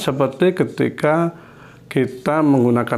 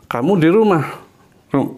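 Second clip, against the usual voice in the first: usual speaking voice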